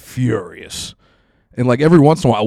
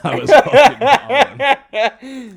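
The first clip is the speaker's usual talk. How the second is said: squeezed out through big laughter; real laughter, stretched out slowly; laughing hard; 12 dB louder, much slower than usual. Real, not acted. Laughing at his own story.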